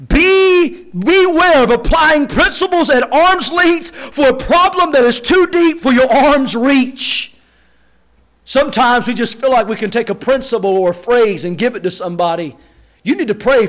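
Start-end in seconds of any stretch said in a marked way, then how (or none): 0.1-7.3 slow, deliberate, every word weighed; slow weighty repeat. Shown as a demonstration. Preacher repeats the quote slowly so the church listens. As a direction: drag it out so it sinks in. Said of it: "please do listen".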